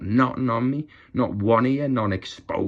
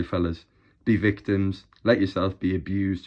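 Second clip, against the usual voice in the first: normal talking voice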